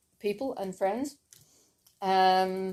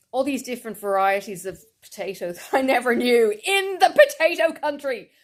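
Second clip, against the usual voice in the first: excited disbelief; 5 semitones higher, 6 dB louder than usual. Real, not acted. Amazed at potato varieties.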